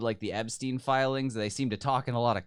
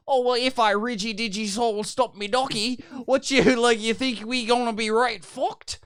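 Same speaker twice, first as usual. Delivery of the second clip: high, loud, exaggerated Australian accent; mock Aussie slang; 10 semitones higher, 6 dB louder than usual. Mocking how Australians talk in a serious conversation, hammed-up Australian accent. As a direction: ham up a silly Australian accent. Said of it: "You're like".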